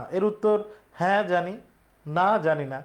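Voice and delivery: ordinary talk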